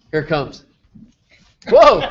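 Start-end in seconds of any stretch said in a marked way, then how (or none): 1.7-2.0 loud, high-pitched exclamation; surprised shout. Real, not acted. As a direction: burst out loudly in amazement. Reacts after drum roll reveal.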